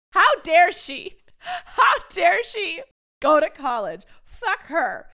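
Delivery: loud, high, slow mock outrage; hammed-up fury over her sister leaving.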